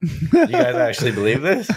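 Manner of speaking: high, loud, stunned question; can't believe what you're hearing; incredulous disbelief. Retold or imitated. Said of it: "and I was like".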